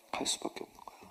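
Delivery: hushed, breathy aside; whisper. Real, not acted. Preacher confiding the point to the congregation in a whisper. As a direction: drop to a near whisper.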